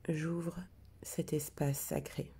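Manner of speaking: hushed, prayer-like recitation of the intention; solemn invocation. Acted. Healer opening the sacred circle for the group, hushed and solemn.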